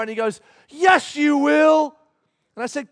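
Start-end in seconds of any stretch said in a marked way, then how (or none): 0.7-2.0 high, loud, drawn-out mock retort; insistent toddler. Three-year-old contradicting his father, loud slow mock insistence. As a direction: argue back like a stubborn child. Acted quarrel, child insisting.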